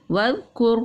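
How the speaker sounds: slow, chanted Quranic reading; slow recitation. Shown as a demonstration. Teacher demonstrating tajweed recitation to pupils, slow chant. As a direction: draw every sound out like in tajweed class. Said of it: "इज़ ज़हब".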